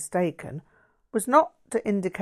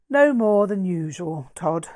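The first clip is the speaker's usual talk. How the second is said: louder, dry sarcastic reply; sarcastic sigh; 7 dB louder than usual. Acted. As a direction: let a weary sarcasm slip out. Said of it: "I sighed, giving in to sarcastic humour".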